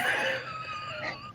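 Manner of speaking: high squeal of laughter. Real, not acted. Laughing at her sleeping dad, high squeal. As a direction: burst into a shrieking laugh.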